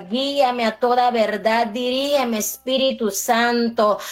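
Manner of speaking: normal talking voice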